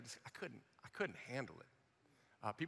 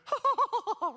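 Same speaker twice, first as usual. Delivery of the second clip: high, bright imitated giggle; girlish laugh; 8 dB louder than usual. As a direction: giggle like a joyful girl. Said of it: "She went".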